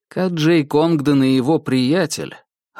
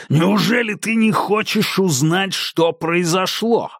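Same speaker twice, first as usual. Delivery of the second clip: taunting call; 7 semitones higher than usual. Acted. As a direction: tempt him out, teasing. Шериф дразнит из темноты. (дразнящий зов)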